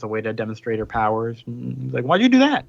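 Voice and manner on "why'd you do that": high, loud acted disbelief; startled protest. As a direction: retell it as an indignant outburst. Acted startled reaction to blown-up tent.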